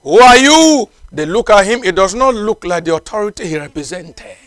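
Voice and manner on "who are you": challenging guard. Acted.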